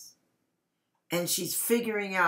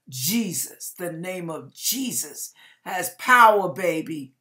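preaching; 5 dB louder than usual. Acted. Preaching declaration.

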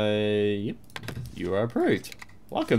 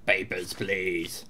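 lower, slow official demand; gruff guard; 5 semitones lower, 7 dB quieter, slower than usual. Pretend. Border guard demanding papers, slow officer voice.

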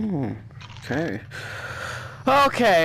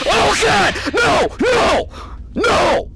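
high panicked no; real fear, yelping; scared gamer screaming no; terrified shrieks; 8 semitones higher, slower than usual. Real, not acted. Animatronic attack.

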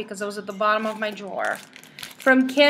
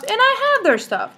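surprised; 11 semitones higher, 4 dB louder than usual. Real, not acted.

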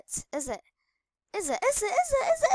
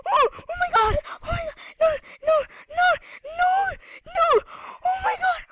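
panicked screaming; 13 semitones higher, 6 dB louder than usual. Real, not acted. Chased in TNT game.

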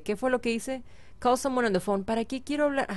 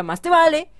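mock threat; 7 semitones higher, 7 dB louder than usual. Threatening rival Sim.

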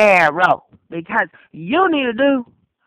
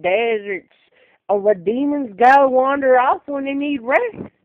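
ordinary talk, nothing marked

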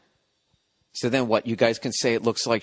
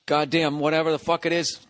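ordinary talk

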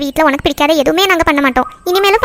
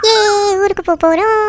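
excited cheer; 5 semitones higher than usual. Acted. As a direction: cheer the trip with stretched-out words. Cheering news of the trip.

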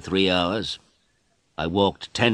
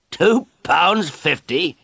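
high, slow disbelieving repeat of the price; incredulous; 11 semitones higher, 5 dB louder, much slower than usual. Acted. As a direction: echo the price as if it's absurd. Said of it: "And I laughed".

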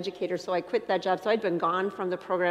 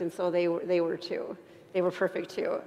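ordinary talk, nothing marked

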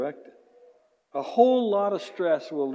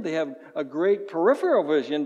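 normal talking voice

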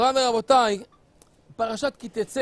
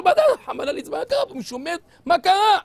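high, urgent frightened asking; alarmed questions; 7 semitones higher, 4 dB louder, faster than usual. Acted. Young Kahaneman asking the son-in-law frantically, alarmed. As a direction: demand to know what happened.

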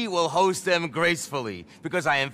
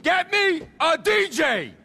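high, drawn-out yell; shouted demand; 9 semitones higher than usual. Acted. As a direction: slowly shout the order at your staff. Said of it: "You shouted at me a lot".

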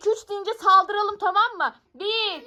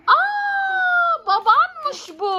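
surprised shout; 10 semitones higher, much slower than usual. Real, not acted. Unmasking thief, it is dad.

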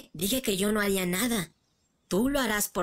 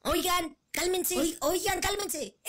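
high squeaky peacemaker; 10 semitones higher, 4 dB quieter, slower than usual. Acted. Trying to stop the fight.

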